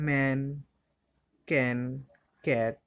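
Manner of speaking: ম্যান, ক্যান, ক্যাট each with the "ae" of cat; A pronounced "ae". Shown as a demonstration. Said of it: "এর উচ্চারণ আবার এ হয়". (ম্যান, ক্যান, ক্যাট-এ A-এর উচ্চারণ "অ্যা")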